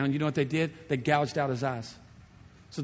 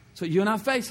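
normal talking voice

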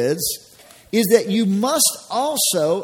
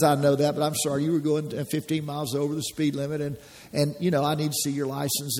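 low, softer, even-toned officer; steady and unbothered, just doing his job; a policeman calmly asking for your license; calm unemotional cop; 5 semitones lower, 5 dB quieter than usual. Retold or imitated. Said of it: "the consequences are very unemotional".

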